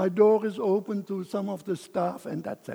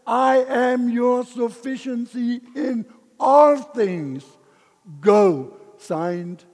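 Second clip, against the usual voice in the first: louder, slow, ringing words; triumphant proclamation; 6 semitones higher, 10 dB louder, slower than usual. Retold or imitated. Man reading God's reassuring letter aloud. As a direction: proclaim God's promise with full conviction. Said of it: "God gave me another letter".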